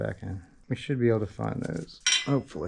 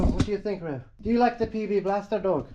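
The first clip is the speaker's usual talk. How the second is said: higher, sing-song to the dog; talking to a dog; 7 semitones higher than usual. Real, not acted. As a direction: coo at the dog.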